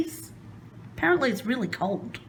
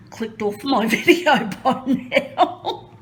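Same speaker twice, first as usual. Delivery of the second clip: laughing; 5 semitones higher, 5 dB louder, slower than usual. Real, not acted. Nervous joke about viewers leaving.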